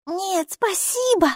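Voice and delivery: put-on high, slow polite little girl. A narrator's reading. Girl politely refusing the neighbour's offer, childlike. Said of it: "вежливо ответила Майки".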